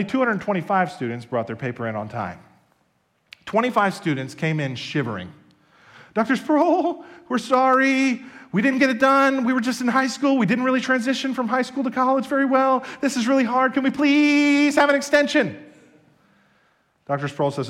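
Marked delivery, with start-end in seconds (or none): 6.1-15.6 high, whiny pleading student voice. Retold or imitated. Students begging the professor, high and whiny. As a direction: beg the professor like a sorry freshman.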